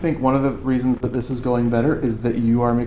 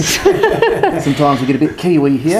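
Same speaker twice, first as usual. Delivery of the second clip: put-on New Zealand accent; 4 dB louder than usual. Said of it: "Me and Brock both have a favorite accent".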